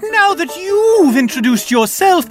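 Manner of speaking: high, loud, drawn-out gnome voice; showy little gnome herald; prankster gnome. Acted. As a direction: announce yourself grandly in a high pitch. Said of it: "the gnome steps forward again, even closer to you, and says".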